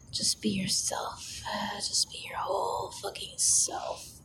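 breathy ASMR whisper, close to the mic; intimate whisper. Acted. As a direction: whisper it like a secret in his ear.

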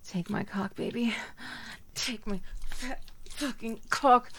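usual speaking voice

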